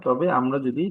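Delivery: ordinary talk, nothing marked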